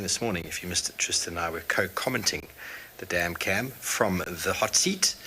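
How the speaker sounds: ordinary talk, nothing marked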